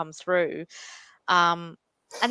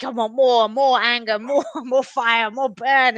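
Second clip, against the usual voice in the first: greedy craving; 5 semitones higher, 8 dB louder, slower than usual. Retold or imitated. Ego craving more anger and fire.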